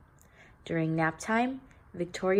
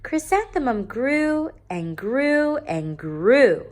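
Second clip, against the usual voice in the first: higher and louder, slow, stretching each grew; building emphasis; 7 semitones higher, 6 dB louder, slower than usual. A narrator's reading. Narrator marking her growth, slow and emphatic. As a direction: grow the phrase as she grows.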